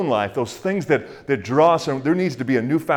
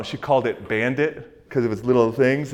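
ordinary talk, nothing marked

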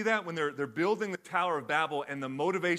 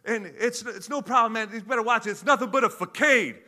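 higher and louder retake of the line; 7 semitones higher, 9 dB louder than usual. Retold or imitated. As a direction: punch it up, a hammed-up second take. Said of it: "you wanna read that again?".

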